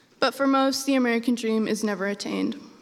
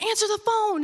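mom yelling; 9 semitones higher than usual. Retold or imitated. Mom ordering daughter to answer, high urgent yell.

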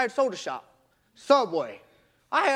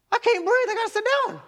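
high-pitched retold distress; acted breathless alarm; 8 semitones higher, faster than usual. Runner retelling a collapse at home, mock breathless alarm. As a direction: act out being winded, voice pitched well up. Acting out his breathless episode.

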